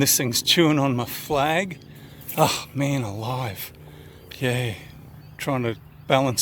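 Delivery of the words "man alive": usual speaking voice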